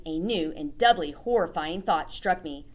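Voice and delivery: usual speaking voice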